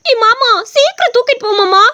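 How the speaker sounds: put-on young wife pleading with her husband to hurry; whiny young woman. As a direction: nag him to hurry, complaining of her aching hips. Voices the wife Godavari.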